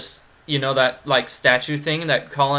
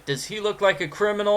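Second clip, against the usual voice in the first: first option pitched up and quick; higher, quicker question; 6 semitones higher, faster than usual. Acted. Streamer offering viewers the first choice, higher and quicker. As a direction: ask it high and brisk. Poses the same question two ways.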